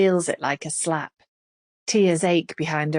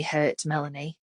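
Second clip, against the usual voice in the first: ordinary delivery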